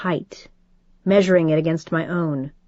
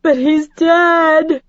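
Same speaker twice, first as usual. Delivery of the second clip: high, loud, slow wail; grieving mother wailing; 9 semitones higher, 5 dB louder, much slower than usual. Acted. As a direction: wail in grief. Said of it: "she wailed".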